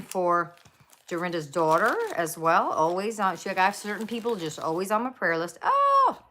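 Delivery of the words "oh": sudden high delighted exclamation; surprised oh. Real, not acted. Vlogger spotting something in her haul, high surprised cry. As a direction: gasp it out at a surprise.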